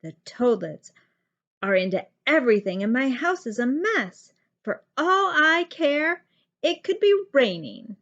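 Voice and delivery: loud, irritated complaint; fed-up mother venting; grumpy snap. A narrator's reading. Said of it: "Gertie snapped".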